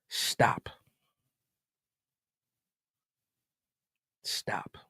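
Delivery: breathy, voiceless plea to stop; a pleading whispered stop. Real, not acted. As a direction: hush it out as if begging.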